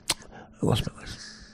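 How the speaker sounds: breathy whispered repeat, slow. Retold or imitated. Telling the story of his lost license, in a slow whisper. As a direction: let the line die to a slow whisper. Repeats the line, voice dropping away.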